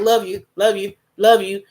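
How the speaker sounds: quick throwaway "love you", repeated without the I; offhand, hurried "love you"; impersonal. Shown as a demonstration. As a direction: rattle off "love you" casually, as if it costs nothing. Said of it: "it doesn't sound very personable".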